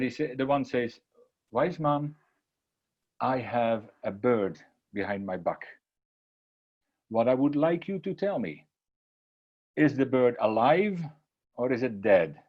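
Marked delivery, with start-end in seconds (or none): 1.5-12.4 slow, measured question with long pauses; deliberate challenge. Retold or imitated. Boy posing a trick question to the wise man, deliberately drawn out. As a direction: lay out the trap piece by piece.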